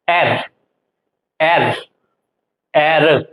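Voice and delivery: normal talking voice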